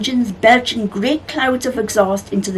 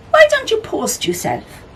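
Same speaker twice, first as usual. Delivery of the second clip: character voice; 14 semitones higher than usual. A narrator's reading.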